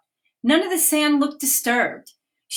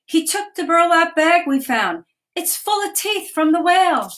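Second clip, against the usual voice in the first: higher, slightly louder urgent report; mild mock shout; 7 semitones higher, 3 dB louder than usual. Girls telling the police about the stolen bag, mild mock shout. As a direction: call it out to the officers, half-hearted shout. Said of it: "They pointed to the man and shouted".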